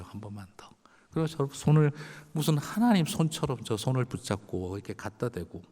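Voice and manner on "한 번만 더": breathless whisper asking once more; whispered plea. Retold or imitated. Cancer patient begging the pastor for one more prayer, whispered. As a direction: barely voice the last request. Dying member begs for prayer.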